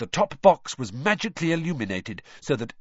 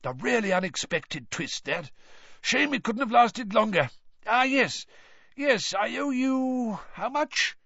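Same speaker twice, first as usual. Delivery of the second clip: hoarse, higher voice, slowing at the end; 10 semitones higher than usual. A narrator's reading. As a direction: keep the worn-out hoarse voice. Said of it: "he said hoarsely".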